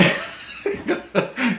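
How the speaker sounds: high laugh at own impression; real laugh. Real, not acted. Amused by imitating the screaming kid, laughing. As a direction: burst out laughing.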